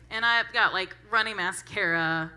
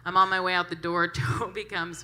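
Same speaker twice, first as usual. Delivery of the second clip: ordinary delivery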